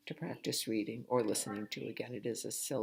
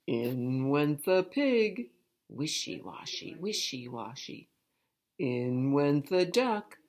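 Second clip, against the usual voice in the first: sing-song refrain. A narrator's reading. Rhythmic washing refrain.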